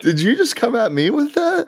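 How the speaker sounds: high, incredulous squeak; surprised. Real, not acted. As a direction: caught off guard, pitch jumps up. Mock outrage at partner's jab.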